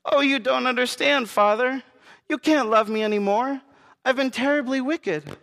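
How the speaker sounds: put-on high, pleading girl voice; a guilty daughter begging her father; anguished child confession. A narrator's reading. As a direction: plead that you are wicked.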